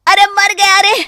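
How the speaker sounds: high, loud pained howls; crying out in pain. Acted. Rohit, hit again in the guest room, cries out. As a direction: howl under the blows.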